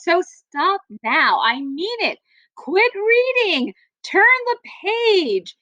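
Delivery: high, slow mock-stern order to the reader; slowly and playfully bossy, shooing the reader on. Teacher reading the book's bossy narrator, mock stern.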